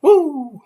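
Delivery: high, loud "woo"; whooped woo. Real, not acted. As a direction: let out a sudden high whoop. Exclaims over their coming trouble.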